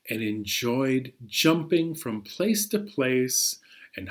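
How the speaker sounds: put-on soft, hushed read-aloud for children; storybook reading. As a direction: draw the kids into the tale gently. Reads the picture book aloud.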